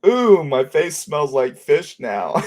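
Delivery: high, slow, loud mock complaint; drawn-out high kid's grossed-out line; imitated daughter. Dad voicing his daughter at dinner, high mock complaint. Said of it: "she goes".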